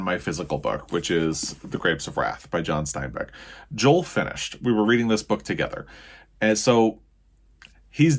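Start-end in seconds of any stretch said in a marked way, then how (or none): none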